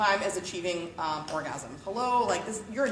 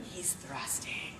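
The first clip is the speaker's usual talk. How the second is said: breathy, slow whispered aside; hushed whisper; 9 semitones lower, 13 dB quieter, much slower than usual. Real, not acted. Host whispering to the party guests about the toy. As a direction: whisper it as if sharing a secret.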